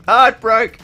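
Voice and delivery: high, loud imitated panic; playful mock dismay; mock alarmed cry. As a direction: blurt it like the stream just died. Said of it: "I'm like".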